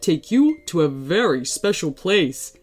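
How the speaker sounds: enticing, lively tree voice; friendly talking tree. Acted. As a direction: promise it like a genial magical tree.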